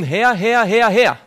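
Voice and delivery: loud, high, near-shouted cry; ecstatic crying out. Retold or imitated. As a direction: scream it like a worshipper collapsing in frenzy. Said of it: "umfallen und rufen".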